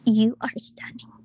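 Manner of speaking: soft hushed fatherly voice; a hushed, loving father; tender father. Retold or imitated. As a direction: breathe it like a doting dad. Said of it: "Her father was like".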